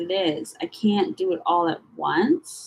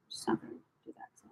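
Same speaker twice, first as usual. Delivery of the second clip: whispered under her breath to herself; 15 dB quieter, much faster than usual. Real, not acted. Muttering to herself while sorting files, whispered. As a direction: breathe it out almost unheard.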